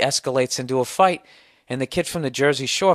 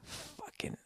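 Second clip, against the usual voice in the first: breathy whispered curse under the breath; fed-up whisper; exasperated whisper; 25 dB quieter than usual. Real, not acted. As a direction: hiss it in disbelief. Exasperated aside after the story.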